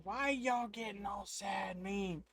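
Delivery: a hint of a hollering old shopkeeper; hooting shopkeeper. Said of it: "hooting and hollering".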